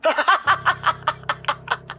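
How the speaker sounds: a long, soft contemptuous laugh; mocking laugh. Grandmother laughing off the kidnapper, soft and mocking. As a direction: laugh at his threat.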